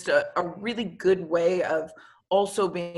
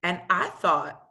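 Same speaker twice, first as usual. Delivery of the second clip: usual speaking voice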